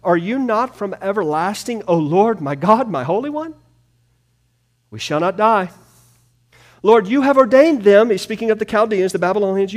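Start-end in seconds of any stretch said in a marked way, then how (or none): 0.0-8.1 high, loud, pleading cry; crying back to God. Acted. Habakkuk crying back to God, high loud plea. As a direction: lift it to heaven in anguished protest. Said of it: "Habakkuk crying back to God".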